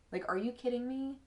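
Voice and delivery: high-pitched, incredulous question; can't believe how well it fits; amazed disbelief. Real, not acted. Reader to viewers about the uncanny cards, amazed disbelief.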